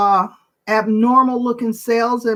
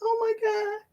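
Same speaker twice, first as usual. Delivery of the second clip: real crying breaking through the words; about to cry; 12 semitones higher than usual. Real, not acted. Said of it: "I feel like I'm about to cry".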